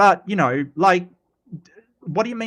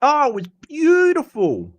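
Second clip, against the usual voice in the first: put-on high, exclaimed Queenslander accent; Steve Irwin; 11 semitones higher, 4 dB louder than usual. Streamer repeating the accent demo, high Queenslander Steve Irwin impression. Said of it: "definitely Austra-, uh, Queensland, Australian".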